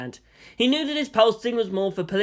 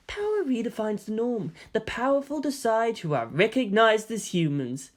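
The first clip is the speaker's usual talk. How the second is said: higher, honeyed villain voice; Caro, villain welcome; 4 semitones higher, 3 dB quieter than usual. Acted. Caro welcomes humanity to his world, smug. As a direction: greet them like a smug new master. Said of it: "said Caro".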